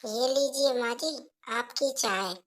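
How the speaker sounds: put-on soft, timid young wife offering tea; softly offering tea to her mother-in-law; meek daughter-in-law. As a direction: hand over the tea quietly and politely.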